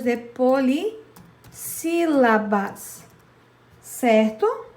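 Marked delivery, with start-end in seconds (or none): 0.0-3.0 "polissílabas" slow and emphatic, every syllable clear; stressed term. Shown as a demonstration. Teacher naming the term for the class, slow and emphatic. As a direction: lean on "polissílabas", x0.5. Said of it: "são chamadas".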